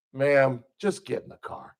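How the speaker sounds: just get her in the car; tired cop mutter. Retold or imitated. Weary officer telling the woman to get in the car.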